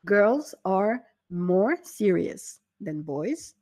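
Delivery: slow and careful example; slow model sentence. Shown as a demonstration. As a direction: spell it out slowly for learners. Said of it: "Donc, ça nous donne".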